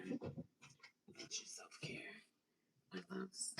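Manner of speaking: hushed whisper to the viewers; whispered aside. Real, not acted. She whispers self-care advice to her loves. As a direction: lean in and whisper it.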